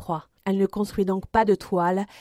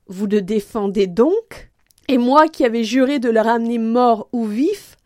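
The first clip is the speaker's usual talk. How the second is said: dismayed reproach; 2 semitones higher, 5 dB louder than usual. Acted. D'Artagnan torn before Athos, dismayed and building. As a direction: protest your broken oath to a friend. Anguished reproach to his friend.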